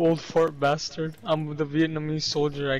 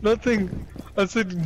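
normal talking voice

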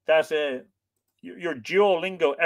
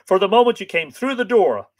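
loud, high, elated declaration; rejoicing hero, voice raised; joyful cry; 7 semitones higher, 7 dB louder than usual. A narrator's reading. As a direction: declare love with a burst of joy. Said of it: "Fionn cried joyfully".

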